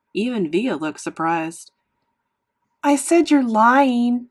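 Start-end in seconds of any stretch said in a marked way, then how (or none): 2.8-4.3 slow, loud accusing shout. A narrator's reading. Boy accusing his mother, shouting. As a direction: raise pitch and shout it slowly. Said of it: "I shouted".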